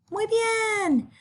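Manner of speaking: very high, drawn-out teacherly praise; high-pitched praise. Real, not acted.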